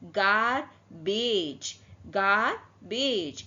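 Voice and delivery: very slow "गारबेज": hard g first, j last; hard and soft G. Shown as a demonstration. Teacher showing how to read the word, slow demonstration. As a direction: stretch "गारबेज" into two long syllables. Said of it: "अब इसको कैसे पढ़ेंगे".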